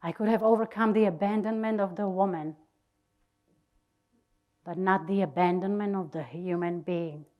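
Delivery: usual speaking voice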